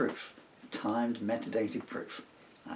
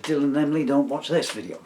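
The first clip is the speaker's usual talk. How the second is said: loud, raised-pitch warning; much louder and higher aside; calling out; 6 semitones higher, 13 dB louder than usual. Real, not acted. Calls out warning to children.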